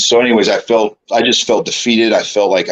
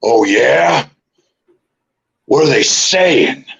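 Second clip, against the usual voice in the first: Vince McMahon; 3 semitones higher, much slower than usual. Retold or imitated. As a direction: draw out the question as a mock boss. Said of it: "his head comes up and he goes".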